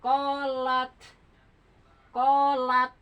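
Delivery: "Kolat" with the final t stopped hard then released with a little bounce; bouncing final t. Shown as a demonstration. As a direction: say "kolat" so the closing t is held, then pops out. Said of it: "Jadi saya begini".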